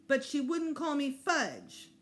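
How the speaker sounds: lightly voiced sulky small child; whiny little boy. Fudge complaining to the principal, slow and sulky.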